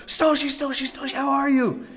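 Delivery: high calling voice; imitating families. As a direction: call out from a car window.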